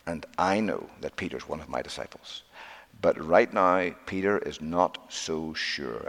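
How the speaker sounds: low, soft, patient reply; gentle explanation. Acted. Imagined Lord explaining Peter's doubt to an angel, gentle. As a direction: explain it quietly and low, with care.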